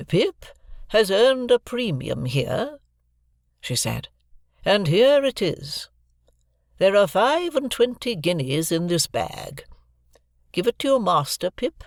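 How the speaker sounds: put-on grand, measured old lady handing over money; Miss Havisham's voice. A narrator's reading. Miss Havisham giving Joe the premium, stately and slow.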